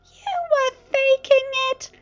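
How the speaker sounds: very high, loud, slow accusation; accusing. A narrator's reading. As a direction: accuse him outright. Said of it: "Spike stipulated".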